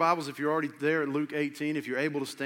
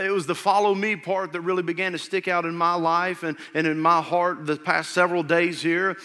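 rising, louder pulpit preaching; 5 semitones higher, 10 dB louder than usual. Acted. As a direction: build it up like a preacher. Preaching builds to full force.

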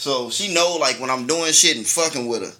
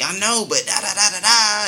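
mocking girlfriend; 6 semitones higher than usual. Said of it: "And she was like".

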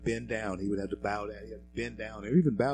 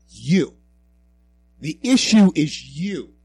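loud, higher, jabbing YOU; loud accusation; 7 semitones higher, 13 dB louder, slower than usual. Real, not acted. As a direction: point the finger and call him out. Pointed accusation at Cuomo.

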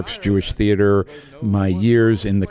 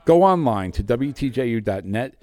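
normal talking voice

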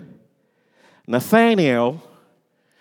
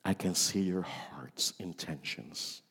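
whisper; 11 semitones lower, 15 dB quieter than usual. Acted. Intimate whispered line.

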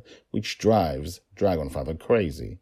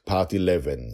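ordinary delivery, nothing marked

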